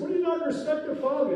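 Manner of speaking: high, loud scolding father; a father snapping at his daughter; angry father. Retold or imitated. Father scolding his daughter for chutzpah, loud. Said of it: "father said".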